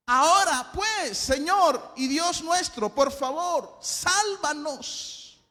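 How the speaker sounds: loud, high scripture prayer, long-held key words; impassioned preaching. Acted. Preacher reads the king's prayer to the congregation, impassioned. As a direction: cry the prayer out to God.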